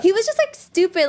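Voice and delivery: high indignant exclaim; indignant high burst. Real, not acted. Daughter telling friend about dad's insults, indignant burst. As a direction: spit it out in disbelief.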